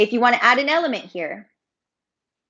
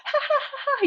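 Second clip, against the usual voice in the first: high-pitched demonstration laugh; mock laughing attack; faster than usual. Shown as a demonstration. Yoga teacher showing how belly muscles work, mock laughing. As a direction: act out a fit of ha-ha laughter. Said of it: "if you're having a laughing attack".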